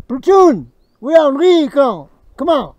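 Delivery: rally the platoon like a drill sergeant; shouting to troops to move out; army commander call. Acted.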